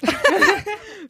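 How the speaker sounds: bright, high laugh bursting out; high burst of laughter. Real, not acted. Laughing high at Fibre's bar joke.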